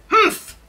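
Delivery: loud, high, dismissive humph; sharp miserly huff. A narrator's reading. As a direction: cut them off with an indignant humph. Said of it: "cuts you off with a loud".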